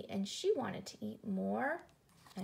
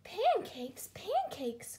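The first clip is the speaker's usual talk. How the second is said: put-on high, excited child elves; elf children crying out; 8 semitones higher, slower than usual. A narrator's reading. Elf children jumping up and down, crying out. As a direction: cry it out like eager little children. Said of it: "cried the elf children".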